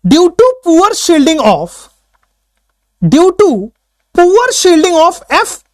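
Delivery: each word drawn out very slowly; slow dictation. Shown as a demonstration. Teacher dictating the definition slowly for notes. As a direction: dictate due to poor shielding word by word. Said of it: "लिखिएगा".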